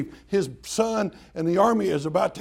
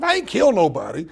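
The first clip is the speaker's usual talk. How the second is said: ordinary talk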